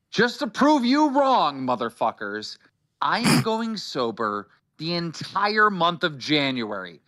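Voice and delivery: high-pitched, emphatic proclamation; defiant vow. Real, not acted. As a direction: swear it to the doubters, drawing out key words. Defiant vow of sobriety to doubters.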